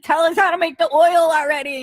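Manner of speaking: impatient loud demand. Retold or imitated. Retelling her impatient demand.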